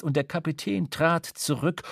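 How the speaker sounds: ordinary delivery, nothing marked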